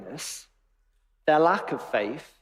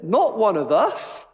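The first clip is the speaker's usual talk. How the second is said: slow, louder incredulous echo; scornful echo, drawn out and raised; mock disbelief; 5 semitones higher, 5 dB louder, slower than usual. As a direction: quote it back slowly, pitched up in disbelief. Mocking echo of disciples' elitism.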